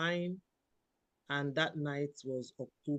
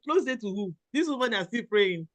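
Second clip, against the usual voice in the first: indignant retort; 5 semitones higher, 7 dB louder than usual. Retold or imitated. Retorting to her mother.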